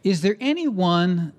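ordinary delivery

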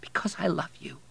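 whispered, near tears; tearful. A narrator's reading. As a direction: whisper it, holding back tears. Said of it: "tears beginning to form".

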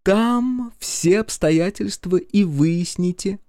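high, sweetly menacing Masha; mock-tender. A narrator's reading. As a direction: coo it like a threat. Masha threatens.

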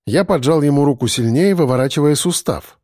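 usual speaking voice

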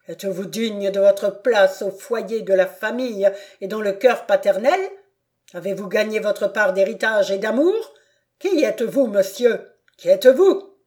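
loud, fast and scornful, rising to a shout; a father's furious, shouting tirade; enraged tirade. Acted. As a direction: pour contempt on the cursed child. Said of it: "s'écria-t-il".

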